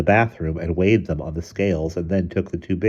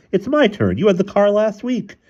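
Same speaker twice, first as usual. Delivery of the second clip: high, raised-voice arguing; indignant protest; 5 dB louder than usual. A narrator's reading. Husband claiming his turn for the car, indignant. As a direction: argue it hotly in a high voice.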